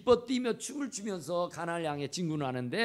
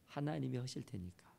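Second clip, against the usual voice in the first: ordinary delivery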